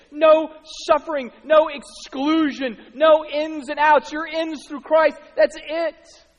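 building to high, very loud proclamation; impassioned preaching. Real, not acted. Preacher proclaiming everybody welcome through the cross, triumphant full force.

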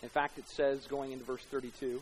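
ordinary talk, nothing marked

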